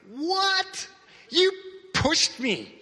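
outraged what just happened; shocked disbelief. Retold or imitated. Knocked to the floor by the praying man, high indignant protest. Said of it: "I'm not yelling this, but in my mind".

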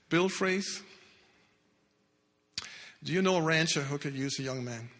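normal talking voice

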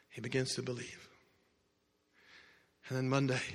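hushed storytelling. Acted. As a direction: draw them in quietly. Quiet dramatic sermon narration.

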